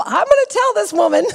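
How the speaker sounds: high, loud hammed-up indignation; mock outrage. Studio owner's first thought about the irate woman, mock outrage. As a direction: blurt it as an acted, worked-up threat. Acts out first impulse to confront.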